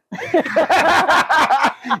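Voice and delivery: cracks up at his own comeback; real hearty laugh. Real, not acted. Retelling the phone call, laughing hard.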